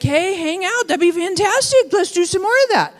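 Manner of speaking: bubbling mock enthusiasm over a raise; excited gush. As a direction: gush like you just got a raise. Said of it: "You're like".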